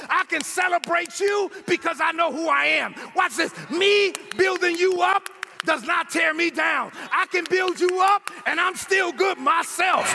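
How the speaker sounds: high, emphatic exhortation to the congregation; impassioned sermon delivery; full-force preaching. Real, not acted. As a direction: drive each point home, rising to a cry.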